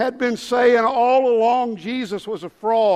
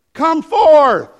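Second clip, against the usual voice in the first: high booming command; Jesus shouting; 6 semitones higher, 11 dB louder than usual. Acted. Preacher as Jesus orders Lazarus out, shouting. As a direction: shout it into the tomb. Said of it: "the Bible says in a loud voice".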